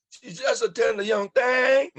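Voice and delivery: high, loud playful holler; teasing shout. Acted. Leonard teasing Henry across the party, shouting. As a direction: rib him loudly across the room.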